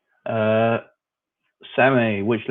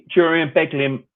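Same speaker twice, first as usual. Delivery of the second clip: higher and louder, savouring the names; proudly echoing his commentary duo, higher and louder; gleeful emphatic repeat; 6 semitones higher, 6 dB louder than usual. Real, not acted.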